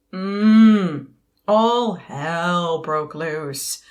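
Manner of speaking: loud groan, then slow heavy words; big, slow, ominous declaration; dramatic doom. Acted. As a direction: drag it out loudly for full drama. Dramatic climax about turning forty.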